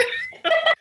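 shrill laugh well above own pitch; high burst of laughter. Real, not acted. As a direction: let out a squealing laugh. Laughter again.

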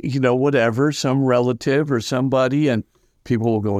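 ordinary talk

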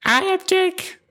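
squeaky high intro voice; high put-on voice. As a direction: say your name way up high. Mock formal self-introduction.

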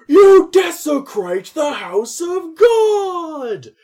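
high, loud, slow thundering priest; outraged priest. Retold or imitated. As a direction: boom it out like a scandalised priest. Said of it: "the priest is something like".

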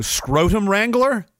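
usual speaking voice

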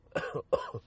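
single dry cough; short cough into the mic. Real, not acted. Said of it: "excuse me".

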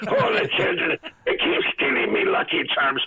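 high-pitched hammy Irish leprechaun; squeaky stage-Irish impression; Lucky Charms Irish accent. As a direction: do a silly cereal-mascot Irish brogue. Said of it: "the Lucky Charms commercial".

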